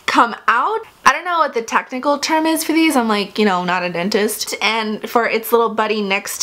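lip pulled down by finger; talking while touching teeth. Real, not acted. Seen 1: Finger pulls lower lip down to show braces, until 1.6s.

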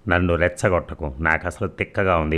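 ordinary delivery

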